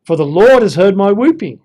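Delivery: confident declaration. Shown as a demonstration. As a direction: announce it with new assurance. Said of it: "now he's telling people off".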